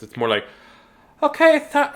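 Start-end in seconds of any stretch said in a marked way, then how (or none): 1.2-1.9 very high squeaky attempt; Christy voice. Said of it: "can't do a Christy voice".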